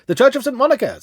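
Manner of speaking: loud, high call to the cabby; urgent call. A narrator's reading. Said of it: "said I".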